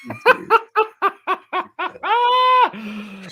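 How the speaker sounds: high burst of real laughter; high-pitched laughing fit; big laugh. Real, not acted. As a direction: crack up at the joke.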